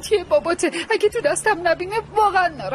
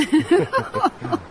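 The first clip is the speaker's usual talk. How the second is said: light laugh; 5 semitones higher than usual. Real, not acted. Mother laughing over hot tea.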